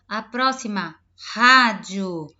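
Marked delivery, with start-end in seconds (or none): 1.3-2.3 rádio with a loud, strong initial r; teaching word. Shown as a demonstration. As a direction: hit the R of rádio hard. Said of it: "o R no início".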